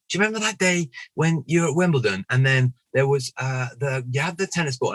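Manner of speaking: put-on fan enthusiasm; imitated reminiscing fan.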